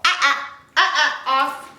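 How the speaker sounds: firm dog-correction sound. Shown as a demonstration. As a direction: stop the puppy with a firm "ah, ah, off". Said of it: "whenever the puppies hear me use that tone".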